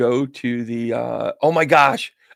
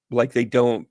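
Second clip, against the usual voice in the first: ordinary talk, nothing marked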